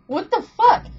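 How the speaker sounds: high, loud indignant outburst; mock outrage. [name] reacts to the boat squabble, mock outrage. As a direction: act out an outraged friend.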